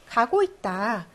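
ordinary talk, nothing marked

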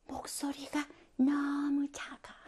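slow, barely audible whisper; drawn-out whispered mimicry; too-quiet presenter. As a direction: imitate a student presenting far too softly. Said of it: "발표의 모습 뭐냐면".

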